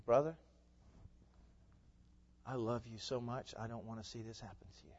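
a soft, tender plea; pleading with grace. Retold or imitated. Believer pleading softly with a sinning brother. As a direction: gently beg a loved brother. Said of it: "with grace on the lips and pleading".

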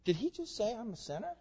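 high, hushed incredulous question; softly acted shock; mock disbelief. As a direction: play a taken-aback listener asking a neighbour quietly. Voices shocked listeners in synagogue.

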